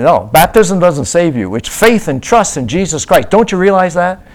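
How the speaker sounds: loud, high mock scolding; hammed-up rebuke. Missionary imitating evangelist correcting a believer, loud mock scolding. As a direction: slap down the answer loudly.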